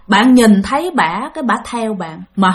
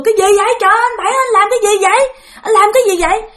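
alarmed shout; 10 semitones higher, 3 dB louder, faster than usual. Retold or imitated. Family yelling at smashing uncle.

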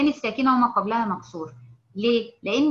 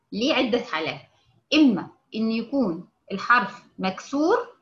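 ordinary talk, nothing marked

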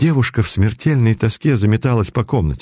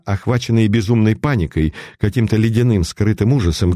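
usual speaking voice

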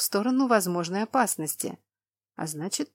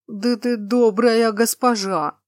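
fish man; 3 semitones higher, 7 dB louder, slower than usual. Acted. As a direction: bubble it out like a swamp giant. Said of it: "низким булькающим голосом пробормотал циклоп".

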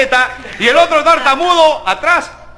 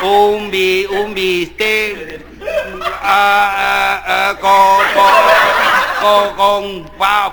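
low, slow stammering order; stuttering; 4 semitones lower, much slower than usual. Acted. Stuttering diner ordering steak from the waiter, low and halting. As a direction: drag out each broken syllable. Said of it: "le dice al mozo".

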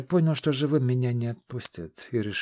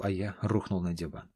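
ordinary delivery

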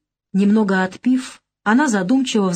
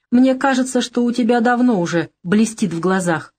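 slightly reproachful; 6 semitones higher than usual. A narrator's reading. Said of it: "чуть укоризненно проговорила Лолита".

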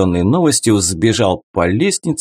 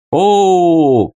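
loud, high booming "О!"; booming exclamation; 8 semitones higher, 4 dB louder than usual. A narrator's reading. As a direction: boom it out like a showman. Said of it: "прогремел голос Грателли".

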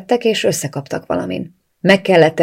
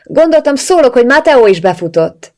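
raised, higher voice calling out to someone inside; calling through the door; 8 semitones higher, 7 dB louder than usual. A narrator's reading. Katie calls to Bianca through the bathroom door. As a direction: call through a closed door, pitched up and loud.

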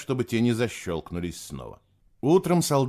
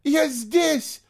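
very high, elated shout; rapturous cry; 4 dB louder than usual. A narrator's reading. Girl joyfully answering her lost sister, rapturous. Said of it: "восторженно выкрикнула она".

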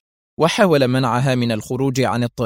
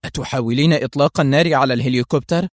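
ordinary delivery, nothing marked

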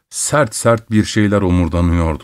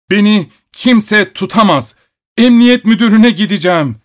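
çok tiz ve yüksek sesle haykırış; şiddetlenen bağırış; 11 semitones higher, 5 dB louder than usual. A narrator's reading. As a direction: kendinden geçmiş gibi avaz avaz bağır. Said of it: "gittikçe şiddetlenen bir sesle bağırıyordu". (müzisyen uşağa, gittikçe şiddetlenen yüksek bağırış)

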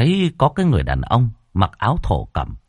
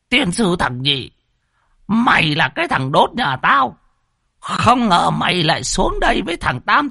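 put-on furious old master; 12 semitones higher than usual. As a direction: roar threats at the traitor.

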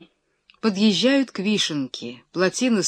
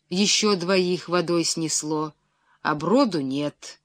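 plain reading voice

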